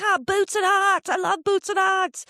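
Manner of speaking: loud, high, slow mock Australian; terrible Australian accent. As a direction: shout out to the crowd in a bad Australian accent. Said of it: "That's my terrible Australian accent".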